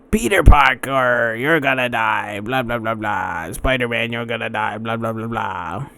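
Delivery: cartoon villain, loud opening, then dropping; mock a smoky-voiced villain's threats, tailing into babble; Hobgoblin. Fan imitating nineties Spider-Man cartoon villain, hammed-up menace. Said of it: "And then he plays the Hobgoblin".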